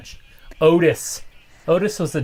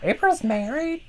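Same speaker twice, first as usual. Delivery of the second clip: high mock voice; 13 semitones higher than usual. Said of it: "she's like".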